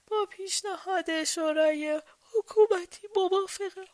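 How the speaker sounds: high-pitched, sleep-slurred king; half-asleep murmur. A narrator's reading. King between sleep and waking agreeing to executions, sleepy murmur. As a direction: mumble it as if still dreaming. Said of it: "در میان خواب و بیداری زمزمه کرد".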